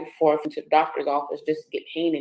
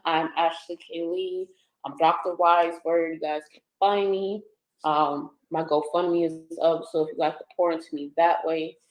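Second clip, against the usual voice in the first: usual speaking voice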